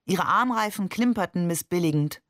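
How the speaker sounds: usual speaking voice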